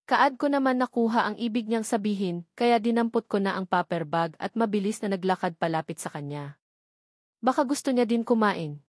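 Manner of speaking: ordinary delivery, nothing marked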